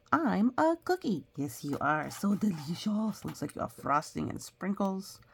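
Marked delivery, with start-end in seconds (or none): 0.1-1.2 higher, louder cookie voice; cookie character. Acted. The cookie narrator greeting the child in a character voice. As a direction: introduce yourself as a cheerful cookie.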